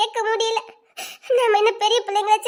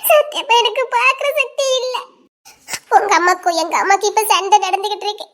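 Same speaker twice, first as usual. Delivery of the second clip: distraught sobbing confession; tearful, gasping between phrases; crying. Acted. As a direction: pour it out through tears. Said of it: "நீ எதுக்கிட்ட அழுதுட்டு வர".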